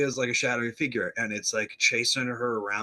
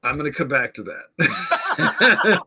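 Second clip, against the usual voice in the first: laughing; 4 semitones higher, 8 dB louder, slower than usual. Real, not acted. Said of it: "we saw this twist coming".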